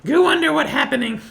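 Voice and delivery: high, loud, simple creature voice; high-pitched curious simpleton; Goo's voice. Acted. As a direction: ask in a squeaky broken-speech voice. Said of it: "I'm gonna ask them".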